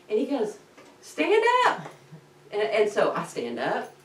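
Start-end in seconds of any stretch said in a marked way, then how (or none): 1.0-1.9 high, loud, drawn command; exasperated call. Retold or imitated. Instructor to student panicking in shallow water, high holler.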